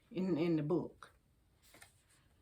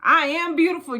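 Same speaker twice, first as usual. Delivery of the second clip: a proud, louder repeat of the affirmation; 6 semitones higher, 5 dB louder, faster than usual. Shown as a demonstration. Repeating her own lines for the sisters, proudly. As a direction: say it again like you mean it. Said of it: "Now repeat after me".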